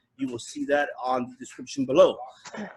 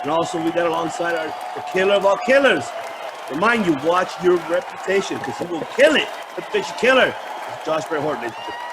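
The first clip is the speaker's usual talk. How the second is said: fast, loud ring announcer intro; 3 dB louder, faster than usual. Acted. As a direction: hype up the killer of killers.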